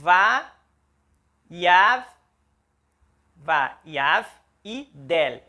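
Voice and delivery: slow, separated syllables va, yav, del. Shown as a demonstration. Teacher spelling out va, yav, del for students. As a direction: break vaiav del into its syllables. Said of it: "Então, a sílaba acontece".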